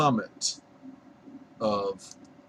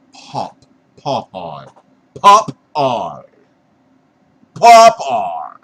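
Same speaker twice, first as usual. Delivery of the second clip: "Popeye" repeated louder, mimicking Yankees; teasing northern "Popeye", louder each time; mocking Yankee accent; 2 semitones lower, 13 dB louder, much slower than usual. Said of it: "Some of you Yanks went".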